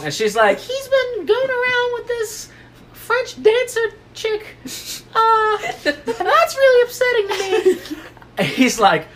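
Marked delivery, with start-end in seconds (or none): 0.6-8.0 mock-weepy Texan daughter squeak; Ruth. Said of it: "she talks like that clearly".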